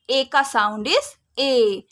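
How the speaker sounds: slow teaching emphasis. Shown as a demonstration. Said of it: "long sound".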